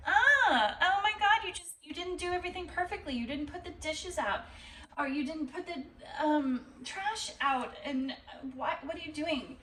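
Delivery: high, fast whining nag with a mock sob; a hammed-up partner griping over chores; whiny complaint. Partner mock-whining that dinner wasn't perfect. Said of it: "you're like".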